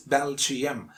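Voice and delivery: Belgium as BEL-jee-um, three syllables; mispronunciation. Shown as a demonstration. Teacher showing the common mistake, mispronounced Belgium. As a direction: say "Belgium" with an extra ee. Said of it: "we pronounce it".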